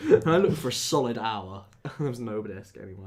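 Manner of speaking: loud, incredulous complaint; exasperated outburst. Real, not acted. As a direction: blurt it out loudly, exasperated. Laughing exasperation at missing disc.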